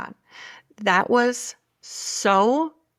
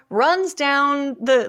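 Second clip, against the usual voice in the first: usual speaking voice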